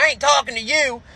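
high, pushed husband voice; husband catching the comic in bed, a high barked challenge; angry husband. Retold or imitated. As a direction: bark it like a caught-out husband with a shotgun. Husband voice continues.

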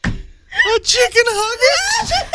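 high, slow, cracking up; laughing. Real, not acted. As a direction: squeal it out through laughter. Repeats punchline, cracking up.

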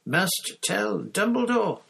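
high, slow, every word costing effort; straining effort. A narrator's reading. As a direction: force it out, desperate. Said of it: "seemed to cost him a terrible effort".

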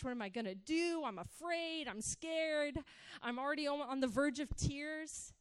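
a hammed-up rising panic about being in trouble; panicked thoughts. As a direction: fret out loud like a scared teen. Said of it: "my heart's going".

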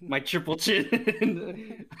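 slow, high, laughing words. Real, not acted. Self-mocking laugh.

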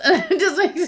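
usual speaking voice

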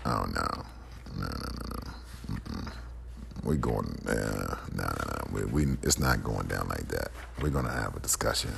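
low, soft repeated no; quiet refusal. Real, not acted. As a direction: refuse under your breath, low and firm. Refuses to accept being dismissed.

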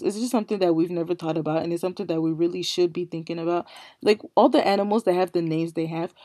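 ordinary delivery

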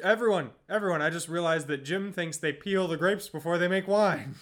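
loud, laughing call to everyone; gleeful announcement. Real, not acted. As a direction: announce it with glee.

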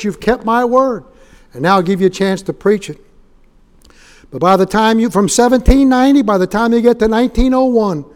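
loud, ringing revival preaching; loud fervent sermon climax; full-force preaching. Acted. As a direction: preach it out to the whole room.

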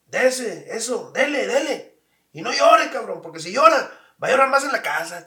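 imitating a tough dad shouting; father's yelled orders. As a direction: bark at the kid to keep fighting. Father urging son in fight.

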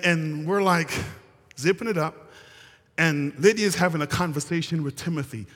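ordinary talk

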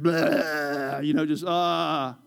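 drawn-out, low "blah" and "ah"; spewing out complaints. Retold or imitated. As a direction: mimic spewing everything out, long and drawn out. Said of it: "I just began to spew".

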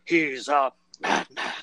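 slow, grave announcement; stern first mate. Retold or imitated. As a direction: warn them solemnly. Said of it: "And he comes out like".

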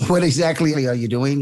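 asks through a chuckle; real laughter spilling into the question; laughing. Real, not acted. Host chuckling as he asks the guest about her job, laughing.

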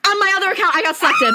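high, loud excited outburst; thrilled announcement. Real, not acted. Finds second account selected, thrilled shouting. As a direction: shout the amazing news to your friend.

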